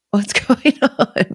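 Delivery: high, slow question broken by a laugh; incredulous, laughing retelling; laughing bewilderment. Retold or imitated. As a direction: ask it loudly through laughter.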